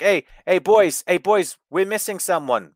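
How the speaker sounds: hammed-up rallying call. Wrestler calling to his stablemates, mock rallying call. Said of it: "I love that he was like".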